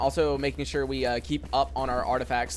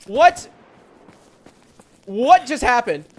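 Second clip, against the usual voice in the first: high, loud panicked yelling; frightened shout; 9 semitones higher, 8 dB louder, slower than usual. Real, not acted. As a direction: yell out in real fright. Startled by creature during stream.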